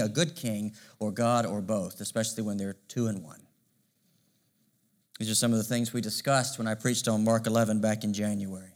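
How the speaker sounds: ordinary talk, nothing marked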